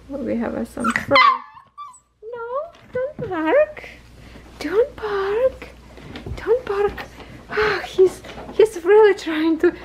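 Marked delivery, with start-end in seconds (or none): 2.2-7.0 much higher gentle don't bark; high pet-talk scold. Real, not acted. Owner gently telling a puppy not to bark, high pet talk. 7.5-9.7 excited. Real, not acted. Puppy climbing.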